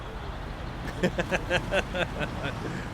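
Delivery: long, voiceless chuckle; a run of quick, airy laughs; amused laugh. Real, not acted. Streamer amused by chat's starship picks, long airy laugh.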